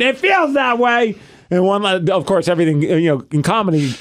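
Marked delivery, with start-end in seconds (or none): 0.0-1.2 high, insistent mock yell; retold yelling. Husband repeating his complaint to his wife, retold yelling. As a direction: dig in with a raised-pitch retort. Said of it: "I'm yelling at her".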